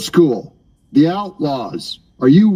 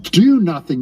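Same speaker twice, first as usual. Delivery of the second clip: ordinary talk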